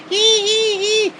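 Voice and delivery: very high, squeaky mock cackle of little monsters; high squeaky goblin giggle; impish giggle. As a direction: cackle like a gleeful gremlin. Imitating enemies giggling.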